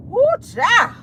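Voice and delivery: a high squeal of shock. Real, not acted. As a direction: shriek it high, scandalized. Reacting to juicy gossip.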